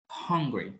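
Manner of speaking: ordinary talk, nothing marked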